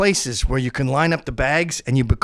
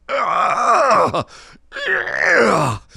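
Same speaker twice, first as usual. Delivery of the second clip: roller coaster groan; 5 semitones higher than usual. Retold or imitated. Kid on a roller coaster, crying out groans. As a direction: groan like a sick kid on a ride. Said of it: "you just sort of go".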